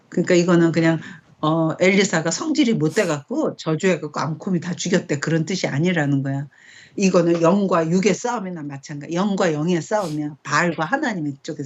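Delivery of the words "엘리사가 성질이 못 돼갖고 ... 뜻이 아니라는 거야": normal talking voice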